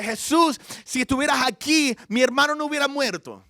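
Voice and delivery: loud, high-pitched reproach; indignant complaint. Retold or imitated. Martha confronting Jesus over her dead brother, loudly complaining. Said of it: "Quejándose con él. Quizás un poco bravo".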